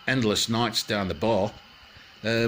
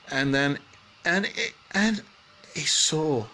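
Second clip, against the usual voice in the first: ordinary delivery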